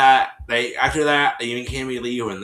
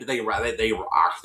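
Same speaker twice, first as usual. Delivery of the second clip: usual speaking voice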